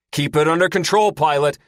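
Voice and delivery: high, commanding bark; loud order. Acted. Commander orders a panicking pilot over the comm, loud. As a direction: order him to hold it together. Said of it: "Porthos orders".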